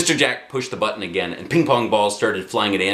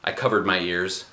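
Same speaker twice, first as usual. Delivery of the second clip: usual speaking voice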